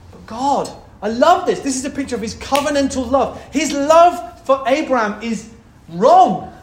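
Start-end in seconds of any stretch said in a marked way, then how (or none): 1.6-6.6 loud, fervent preaching; real passion rising in the voice; passionate. Real, not acted. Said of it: "I'm sorry, I'm getting passionate".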